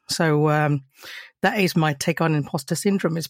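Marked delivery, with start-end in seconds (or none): none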